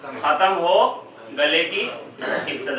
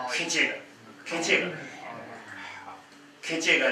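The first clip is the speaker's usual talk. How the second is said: strained throat demo; 4 semitones lower, 11 dB quieter than usual. Shown as a demonstration. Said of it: "गले पे दबाव पड़ेगा".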